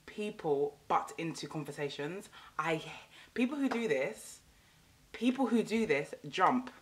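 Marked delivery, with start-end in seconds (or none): none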